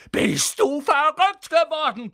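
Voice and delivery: slow, loud, high bellow; boy bellowing at the girl in disbelief. Acted. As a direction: roar it at her. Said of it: "brüllte Ron".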